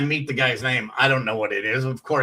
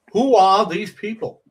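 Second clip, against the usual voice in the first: higher, louder puzzled stand-up comic voice; Jerry Seinfeld impression; 6 semitones higher, 6 dB louder than usual. Shown as a demonstration. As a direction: ask it like a baffled sitcom comic on stage. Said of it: "do your Jerry Seinfeld".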